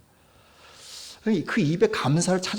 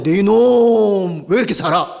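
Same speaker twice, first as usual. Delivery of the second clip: loud, drawn-out rebuke of a sinner; harsh scolding; 4 semitones higher, 10 dB louder, slower than usual. Retold or imitated. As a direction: bellow it like a stern judge. God denies scolding harshly.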